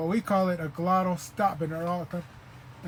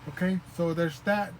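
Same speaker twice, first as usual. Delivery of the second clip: usual speaking voice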